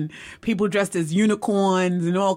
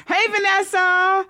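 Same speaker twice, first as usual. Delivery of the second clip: high, drawn-out singsong hello; calling out greeting; 12 semitones higher, 4 dB louder, slower than usual. Real, not acted. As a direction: call out a greeting high and long. Greeting a viewer who joined live.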